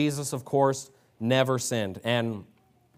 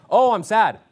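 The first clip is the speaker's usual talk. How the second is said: whiny high "Oh, I'm sad" in mockery; a mock sad sigh; mocking self-pity; 6 semitones higher, 7 dB louder than usual. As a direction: play the moping excuse, pitched up and louder.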